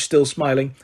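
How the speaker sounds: ordinary delivery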